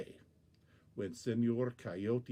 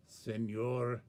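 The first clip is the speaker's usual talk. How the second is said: plain reading voice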